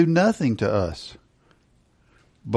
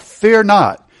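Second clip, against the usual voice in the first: bold proclamation; 5 semitones higher, 6 dB louder than usual. Acted. Proclaiming verse.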